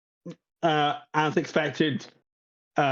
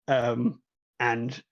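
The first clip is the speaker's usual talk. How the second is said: normal talking voice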